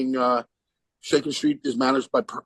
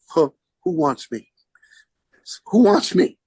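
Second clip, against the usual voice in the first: stunned disbelief; 2 semitones higher, 3 dB louder than usual. Retold or imitated. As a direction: pretend you can't believe they mean you. Stunned reply to surprise call.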